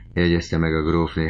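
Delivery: usual speaking voice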